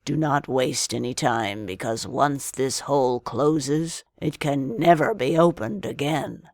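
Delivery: a hint of a sly old man giving orders; old man sending Aladdin into the cave. A narrator's reading. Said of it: "said the old man".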